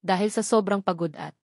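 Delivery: usual speaking voice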